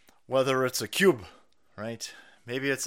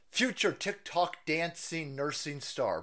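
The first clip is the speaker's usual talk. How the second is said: ordinary talk, nothing marked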